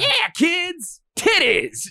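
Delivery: high, sleazy salesman voice; sleazy ad pitch. Retold or imitated. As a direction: sell it like a cheesy promo. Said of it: "it's like".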